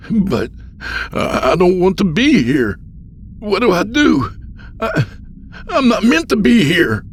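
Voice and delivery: high, loud, distressed protest; panicked Andy. Acted. Stranded Andy pleads with the old man, panicked. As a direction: stammer and plead, pitched far up.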